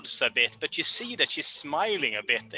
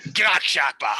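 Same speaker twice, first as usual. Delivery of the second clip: louder, growled-out orc curse; Orcish curse; 6 dB louder than usual. Acted. Orc cursing after a bad swing, louder. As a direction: spit it like an orc swearing. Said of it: "he curses in Orcish".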